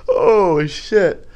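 high, amused sigh after laughing; coming out of laughter. Real, not acted. Podcaster recovering from his own joke, high and laughed-out.

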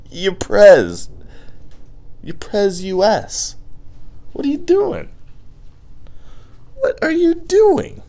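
high, put-on squeaky voice at the president; mock squeaky voice. Scolding the president on Twitter in a high squeaky voice. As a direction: squeal it in disbelief.

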